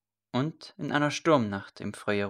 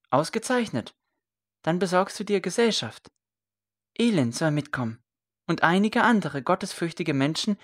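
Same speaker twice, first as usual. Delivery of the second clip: brightened, higher pleading voice; eager son; 6 semitones higher than usual. A narrator's reading. Son pressing his mother to say yes, eager and bright. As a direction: win her over with enthusiasm.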